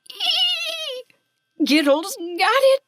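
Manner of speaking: very high squeal of glee; high, loud, gleeful little creature; triumphant squeal. A narrator's reading. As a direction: squeal with delight at grabbing the prize. Character squeals grabbing treasure.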